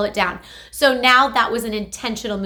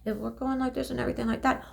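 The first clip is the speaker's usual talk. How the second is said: much softer, fast, tiny mouth; small weak voice; 10 dB quieter, faster than usual. Shown as a demonstration. Therapist demonstrating small speech that weakens muscles. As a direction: show the underused mouth muscles. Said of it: "the same thing goes for the muscles".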